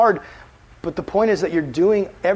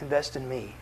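soft plea; 4 semitones lower, 10 dB quieter than usual. Retold or imitated. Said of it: "Jesus' words say".

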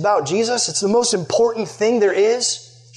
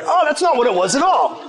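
higher, surprised aha; mock sudden realization; 9 semitones higher than usual. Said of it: "you're like".

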